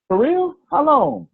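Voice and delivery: high incredulous questions; squeaky disbelief; mock surprise. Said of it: "imagined skeptic asking".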